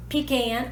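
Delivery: pecan said PEE-can, stress on the first syllable; regional pronunciation. Shown as a demonstration. Host polling viewers on pronunciation, pecan said PEE-can. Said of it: "let me know how you pronounce".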